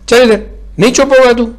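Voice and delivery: high, loud angry command; angry mother. A narrator's reading. Mother dragging her son away, yelling high and loud. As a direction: yank him along with a high yell.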